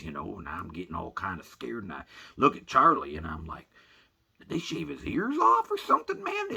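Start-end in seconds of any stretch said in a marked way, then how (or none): 4.4-6.5 very high, loud incredulous question; acted-out squeal of dismay; shocked disbelief. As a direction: let the voice shoot up in alarm. Shocked at shaved dog.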